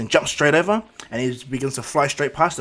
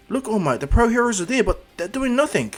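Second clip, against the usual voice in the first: high, louder, agitated report; urgent alarm; 9 semitones higher, 4 dB louder than usual. Acted. Izuku telling All Might heroes stand idle, alarmed. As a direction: point it out urgently, voice raised.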